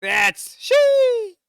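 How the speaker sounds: very high loud falsetto; very high falsetto squeal; shrill cartoon woman. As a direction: squeal it like a shocked lady.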